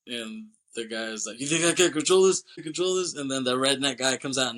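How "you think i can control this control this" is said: panicked yell. Retold or imitated. DEA agent panicking.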